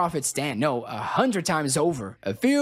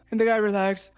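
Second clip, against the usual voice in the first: plain reading voice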